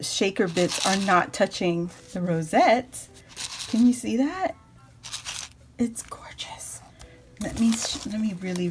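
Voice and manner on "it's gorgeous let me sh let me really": breathy hushed whisper. Real, not acted. Admiring the card's tiny layers, she whispers. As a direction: whisper it in awe. Admiring card detail closely.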